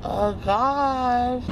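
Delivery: ordinary delivery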